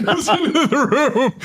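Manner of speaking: slow, high, laughing words; cracking up at the memory. Real, not acted. Speaker retelling the scene, laughing. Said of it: "laughing while telling".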